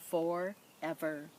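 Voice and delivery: ordinary delivery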